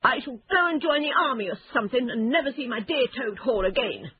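high, rushed self-pitying wail; woeful, hurried and high; miserable. A narrator's reading. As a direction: lament dramatically in a high voice. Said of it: "cried Toad miserably".

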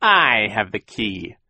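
slow, gloating villain voice; Count Olaf. A narrator's reading. Villain taunts from behind locked gate, gloating. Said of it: "said Count Olaf's voice".

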